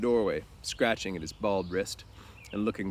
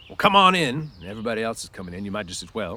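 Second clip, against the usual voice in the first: gruff; 7 dB louder than usual. Acted. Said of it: "conceal his pleasure with anger".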